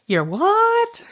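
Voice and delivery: high, incredulous mock holler; imitated calling back. Child in the barn calls to mom, high imitated holler. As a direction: call it back high, as a question. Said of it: "And I said".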